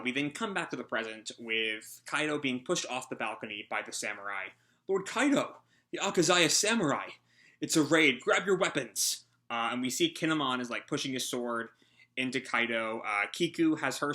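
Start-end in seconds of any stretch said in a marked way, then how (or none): 4.9-9.2 alarmed henchman. Acted.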